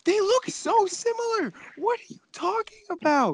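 very high squeaky protest. Acted. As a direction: squeak it out in disbelief. Squeaky high mocking insistence.

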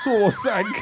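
high laughing mock shout of saw sank; mocking the clue, half yelling through laughter; laughing mockery.

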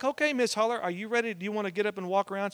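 high, quick imitation of a nurse; perky nurse. Nurse getting grandma up to walk, perky. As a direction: chirp it like a nurse rousing a patient.

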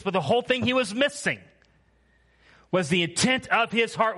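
loud, high preaching of scripture; preaching at full force. Acted. Preacher reading Acts to the church with full force. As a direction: proclaim it to the back row.

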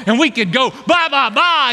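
high babbling syllables; tongues babble. Retold or imitated. Imitating speaking in tongues.

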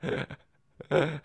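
high, loud rasping creature voice; gloating rasp, unsettling. Acted. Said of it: "a rasping voice".

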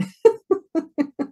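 high burst of laughter; high-pitched laugh. Real, not acted. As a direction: break into a high giggle. Laughing at the dog poop story.

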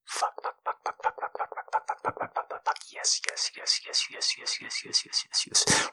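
rapid, soft panting whisper; breathless whisper. Acted. Partner losing control, breathless whispering. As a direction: gasp each word under the breath.

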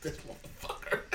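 soft, breathy aside; under-breath mutter. Retold or imitated. Teller reacting to the host's joke, soft muttered aside. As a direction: mutter it half to yourself.

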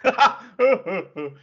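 high, loud burst of laughter. Real, not acted. Co-host cracking up at joke.

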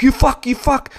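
high, sneering taunt thrown at a passing cyclist; mock aggressive taunting. Muscly guy taunts narrator on bike, mock jeering. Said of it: "And he was like".

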